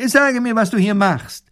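high, loud demand; commanding professor. A narrator's reading. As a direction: demand an answer officiously. Professor orders soldier to explain.

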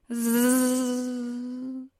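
zzz hum dying away; long insect drone, trailing into silence; fading mosquito buzz. Acted. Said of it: "Das Summen wird leiser".

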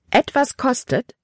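high startled cry of disbelief; exclaimed. A narrator's reading. Girl, shocked by the danger to Papa, exclaims. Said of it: "rief Libby aus".

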